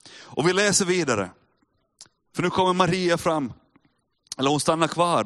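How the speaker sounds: normal talking voice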